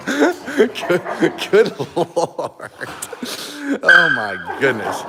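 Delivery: words through laughter; laughing. Real, not acted. Owner laughing at dogs playfighting, words through laughs. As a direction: laugh out the exclamation.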